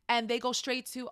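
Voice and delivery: ordinary talk, nothing marked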